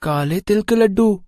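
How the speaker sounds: put-on high young boy's voice, sulky; a small boy insisting he'll have fun; child voice. Little Bunty talking to his parents, high child voice.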